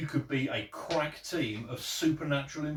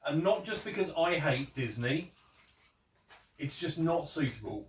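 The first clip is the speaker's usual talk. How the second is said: usual speaking voice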